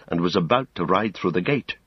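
plain reading voice

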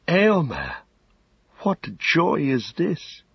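a hint of a young woman just woken, slow; waking lady. A narrator's reading. Eowyn waking to her brother, lightly voiced and slow. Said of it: "But she opened her eyes and said".

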